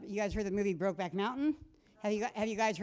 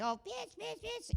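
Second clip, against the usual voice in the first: mocking the ex; 12 semitones higher, 9 dB quieter, slower than usual. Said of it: "Their dad was like".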